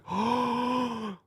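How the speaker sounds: high, breathy mock gasp. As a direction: gasp like you just found gold. Mock amazement at treasure.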